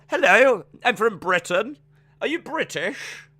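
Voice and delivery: high-pitched mock British accent; exaggerated British accent with a high lilt; put-on British accent. Shown as a demonstration. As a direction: do a hammed-up British hello. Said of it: "do your British accent again".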